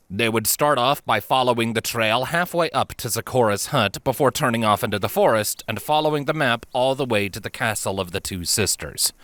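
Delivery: plain reading voice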